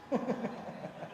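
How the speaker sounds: breathy quiet laugh; soft laugh. Real, not acted. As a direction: chuckle under the breath. Laughing at own joke. (ketawa kecil)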